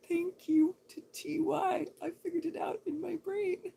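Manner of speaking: high, loud, gushing thanks; a giddy high-pitched thank you; squeaky excited. Real, not acted. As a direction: squeal it out delightedly. Excited gushing reply to viewer.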